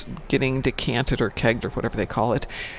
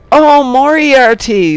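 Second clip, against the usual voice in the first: loud, higher burst of getting the joke; sudden realization; 10 semitones higher, 11 dB louder, slower than usual. Real, not acted. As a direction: shout it as the pun finally clicks. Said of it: "Saying it out loud worked".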